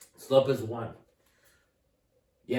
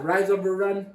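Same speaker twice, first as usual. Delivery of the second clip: "Rise over run" said loud, each word hammered; teacher stressing "Rise over run" loudly for the class; emphatic rule; 5 semitones higher, 6 dB louder than usual. Shown as a demonstration. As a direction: drill the rule into them. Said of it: "The reason I'm emphasizing this".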